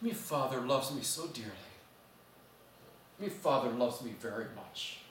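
soft, hushed Scottish "me father"; the old uncle after sunrise, softly awed; tender old uncle. Retold or imitated. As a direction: breathe it out in quiet wonder. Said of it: "Oh, I just realized something wonderful".

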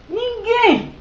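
high, emphatic exclamation; exclaiming. Real, not acted. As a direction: shout the answer out. Exclamação retórica à câmera. (exclamação enfática)

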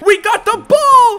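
high, loud cheer; excited shout. Real, not acted. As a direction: yell it in triumph. Team wins the ball.

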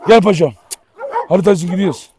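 normal talking voice

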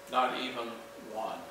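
NOT EVEN, then a break before a low, soft ONE; emphatic pause. Retold or imitated. Preacher quoting scripture to the congregation for emphasis, low and deliberate. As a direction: drop low and set ONE apart after a pause. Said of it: "to make an emphasis, he adds".

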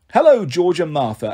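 ordinary delivery

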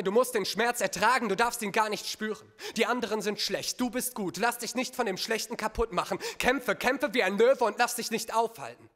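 urgent exhortation. Acted. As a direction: drive your child on to fight. Father urges child to fight.

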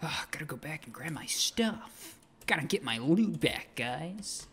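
hammed-up cheery YouTuber greeting; chirpy internet host voice; funny YouTuber voice. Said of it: "like the funny YouTuber voice here".

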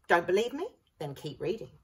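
ordinary delivery

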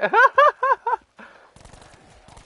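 big high cackling laugh; loud burst of laughter. Real, not acted. Laughing hard at a friend who just fell. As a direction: crack up at the friend who ate it.

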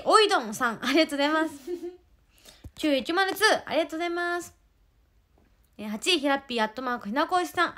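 loud, bright ranking announcement; upbeat ranking roll call; announcer read. Acted. Reads out ranking.

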